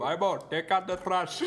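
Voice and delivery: put-on shouted Indian parent accent. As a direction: yell the chore in an Indian accent. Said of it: "then you do it".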